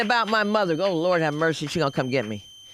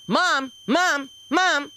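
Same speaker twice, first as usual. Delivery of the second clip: high, loud pleading calls; child calling; 9 semitones higher, 4 dB louder, much slower than usual. Retold or imitated. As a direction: call out like a needy little kid.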